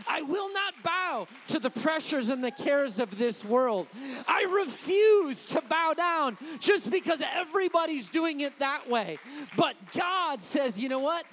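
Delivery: loud, driving preacher's declaration; full-force preaching. Acted. Preacher thunders defiance at the congregation, loud. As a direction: hammer each line out to the congregation.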